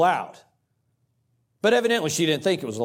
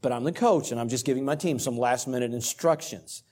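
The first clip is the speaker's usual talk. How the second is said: soft, polite and kind apology; kindly; 4 semitones lower, 4 dB quieter than usual. Retold or imitated. Coach answering the angry official, soft and kind. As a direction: apologize gently, keeping it soft. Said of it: "very kindly I said".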